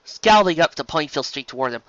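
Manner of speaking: ordinary delivery, nothing marked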